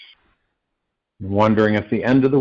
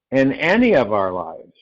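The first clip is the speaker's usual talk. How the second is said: normal talking voice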